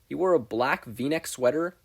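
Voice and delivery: usual speaking voice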